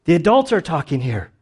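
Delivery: put-on hushing a child, mildly stern; shush, then scold lightly. Crowd hushes the blind man like a kid in church, stern shushing. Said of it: "they were sternly telling him to be quiet".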